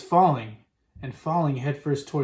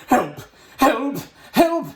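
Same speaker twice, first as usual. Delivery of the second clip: cries climbing higher each time; screaming for help; 9 semitones higher, 4 dB louder, much slower than usual. A narrator's reading. Cook with mouse in trousers, screaming for help. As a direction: scream for help, rising into a high squeal. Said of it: "the man was screaming".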